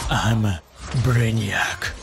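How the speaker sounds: low, menacing alien villain line; Brainiac voice. Retold or imitated. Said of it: "Эта фраза просто, мурашки по коже".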